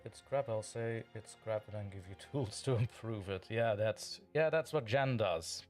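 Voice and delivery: usual speaking voice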